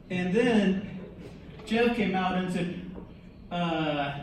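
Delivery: ordinary talk, nothing marked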